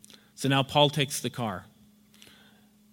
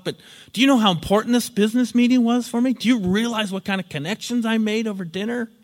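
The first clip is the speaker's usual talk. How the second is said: much higher, whiny wounded pleading; hurt, defensive protest; 8 semitones higher than usual. Retold or imitated. Borrower guilt-tripping his angry friend, whiny and defensive. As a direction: turn it back on him, hurt and indignant. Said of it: "says Paul".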